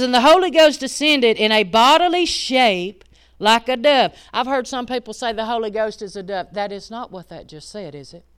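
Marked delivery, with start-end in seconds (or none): 0.0-4.1 scripture reading. Acted.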